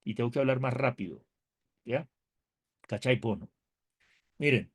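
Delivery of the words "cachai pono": trying on a Chilean accent, lower; playful, still-learning Chilean accent. Shown as a demonstration. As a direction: toss off Chilean slang in a Chilean accent. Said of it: "Me falta aprenderme bien el acento".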